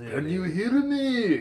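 put-on high, slow accented question; mock Japanese accent.

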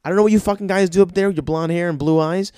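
ordinary delivery

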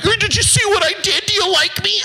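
very high, whiny mock voice begging for approval; needy mock voice. Mocking someone begging for his approval, high and whiny. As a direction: squeak it like an attention seeker.

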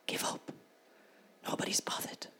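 soft, breathy tempting whisper. Retold or imitated. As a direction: hiss it like a voice of doubt in the ear. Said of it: "you'll hear a voice that says".

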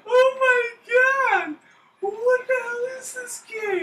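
very high, slow squealed disbelief; incredulous wail. Real, not acted. Player reacts to absurd game, high incredulous wail. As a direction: squeal it out, stretched and helpless.

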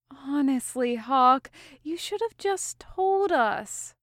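fond exasperation at a friend; mild sighing exasperation; exasperated sigh. A narrator's reading. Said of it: "with an exasperated sigh".

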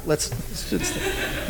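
hushed mock coach whisper. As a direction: drop to a breathy whisper.

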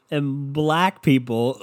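ordinary delivery

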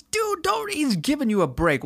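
high pitched disbelief at himself; high incredulous aside; exasperated high voice. Real, not acted. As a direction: squeak out the self-scolding. Scolding his past self.

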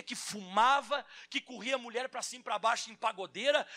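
loud, high, fervent pulpit preaching; preaching at full force. Acted. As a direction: drive the sermon home to the crowd. Pastor preaching to congregation.

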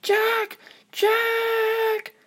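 high, rising calls for her brother; calling out. Acted. Little sister calling her brother from the treetop, high shout. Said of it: "call at the top of her lungs".

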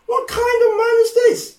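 high amazed question; amazed disciples. Retold or imitated. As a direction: gasp it out in wonder.

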